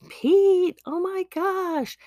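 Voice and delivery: high, loud cry of joy; a father spotting his lost son, overjoyed cry; overjoyed dad. Acted. Dad spots returning son.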